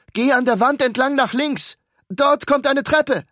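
loud high call, slowing at the end. Acted. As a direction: shout directions up to her. Ruben directs her from below. (laut nach oben gerufene Anweisung)

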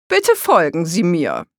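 loud tour-guide call. A narrator's reading. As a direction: call the group along. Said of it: "rief da Oma Rose". (laut rufende Museumsführerin)

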